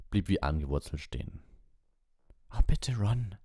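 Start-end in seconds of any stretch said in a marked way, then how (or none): 2.5-3.4 high breathy whispered plea; urgent whisper. A narrator's reading. Hermione urges Ron to keep moving, whispering. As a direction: hiss it urgently, pleading. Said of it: "sagte Hermine".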